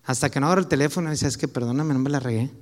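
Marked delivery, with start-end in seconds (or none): 1.3-2.5 sheepish apology. Retold or imitated. Llama arrepentido a la esposa.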